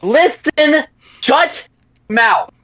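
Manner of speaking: high, forceful shout to silence someone; angry shout. Acted. Employer silences a haggling mercenary, shouting. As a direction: shout each word, cutting him off. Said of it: "as you shout".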